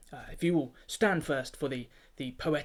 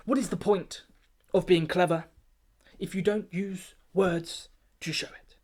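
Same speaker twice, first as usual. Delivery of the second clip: rousing pompous oration; 4 semitones higher than usual. Acted. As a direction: whip up the in-crowd with righteous pride. Keeper's rousing closing speech.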